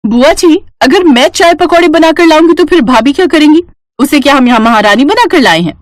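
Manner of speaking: lightly voiced resentful niece; indignant niece. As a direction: object to being given the chore. Voices niece objecting to chore.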